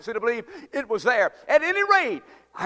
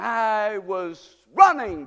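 normal talking voice

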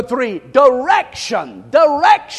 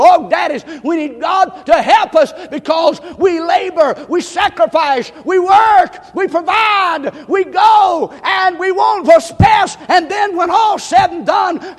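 high, loud preaching climax; full-force preaching shout; 6 semitones higher, 5 dB louder than usual. Acted. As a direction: build and hammer each word to the rafters.

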